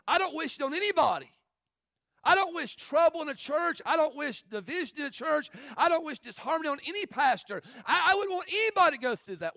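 loud, high, forceful preacher cry; impassioned preaching. Real, not acted. Pastor insisting he wishes trouble on nobody, impassioned shout. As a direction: preach it out at full force, hitting every phrase.

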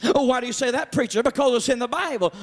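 ordinary delivery

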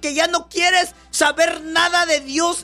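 predicador a voz en cuello, con fervor; predicación enérgica. Acted. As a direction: exhorta a la congregación con fuerza. Preaching to congregation. (predicando con pasión a la congregación)

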